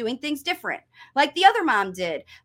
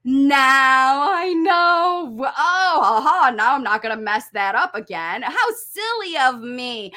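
dawning realisation; 3 dB louder than usual. Acted. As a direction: play a learner delighted at finally getting it. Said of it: "and you're like".

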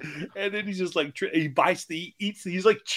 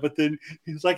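normal talking voice

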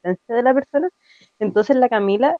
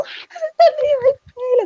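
much higher squealing cry; excited scream. Retold or imitated. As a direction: shriek with fangirl delight. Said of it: "me puse a gritar". (chillido emocionado, mucho más agudo)

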